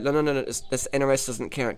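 ordinary talk

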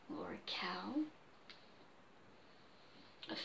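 unvoiced whisper aside; whispered joke. Real, not acted.

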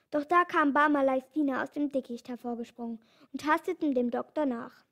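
usual speaking voice

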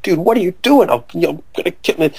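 retold protest. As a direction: plead as if mildly alarmed.

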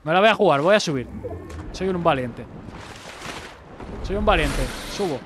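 loud, punchy self-hype; bold declaration. Real, not acted. Player hypes themself to take a risk, bold and loud. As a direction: declare it boldly and loudly.

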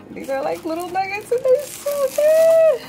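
high-pitched, gushing with delight; excited squeal. Real, not acted. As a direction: squeal it out like a thrilled fan. Gushing over her nuggets.

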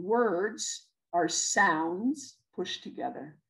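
each word slow and weighted, "Words" leaned on; slow emphatic point. Shown as a demonstration. Teacher stressing the key idea to parents, slow and weighted. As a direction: lay it down slowly so it sinks in. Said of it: "this is the most important thing".

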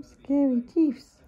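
slow, loud drawn-out scary teeths; teasing mock fright. Owner teasing the cat about its teeth, slow and loud.

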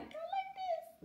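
very high, soft doll squeak; Barbie voice demo. Shown as a demonstration. Mom showing child the strained high doll voice. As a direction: push it way up into doll pitch. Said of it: "can't do that for a long time".

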